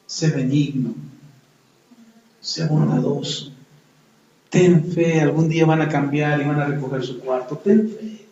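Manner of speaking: soft, gentle encouragement; tender counsel. Acted. Holy Spirit softly counseling the frustrated parent, tender and quiet. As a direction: soothe them like a patient guide.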